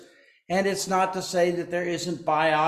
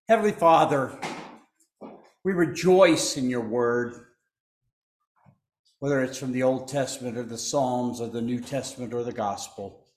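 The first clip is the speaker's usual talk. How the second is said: measured pulpit prayer with held pauses; 4 semitones lower than usual. Acted. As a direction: pray it reverently to the congregation. Pastor leads opening prayer.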